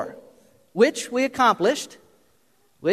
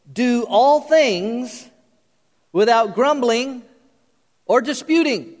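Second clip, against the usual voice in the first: slow, raised, drawn-out scripture reading; deliberate verse reading; 4 semitones higher, slower than usual. Real, not acted. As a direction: stretch each phrase and let it land. Preacher reads key verse aloud emphatically.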